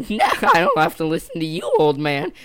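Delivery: loud, cheeky comeback; acted bratty refusal; mock defiant retort. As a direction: throw it back loudly, taunting. Acting kids' defiant comeback.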